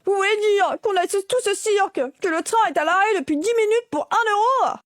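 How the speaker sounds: high, loud indignant disbelief; hammed-up outrage over a tiny fine; outraged passenger. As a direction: play the shocked bystander, voice rising high.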